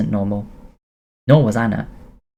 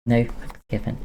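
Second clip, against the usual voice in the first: usual speaking voice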